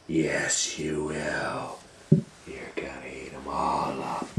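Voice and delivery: low, whispered threat; quiet sinister gorilla; menacing whisper. Acted.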